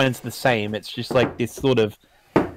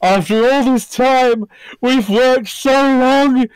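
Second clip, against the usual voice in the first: high, slow, proud and loud; triumphant Soviet announcer; 13 semitones higher, 5 dB louder, slower than usual. Pretend. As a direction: proclaim it like a grand victory speech. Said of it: "it's literally".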